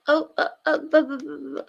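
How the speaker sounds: ordinary delivery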